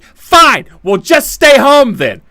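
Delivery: high, loud, exasperated snap; a sudden angry outburst at his wife; snapping yell. Retold or imitated. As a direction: bark it out, fed up and sleepless. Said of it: "Why are you yelling at me?".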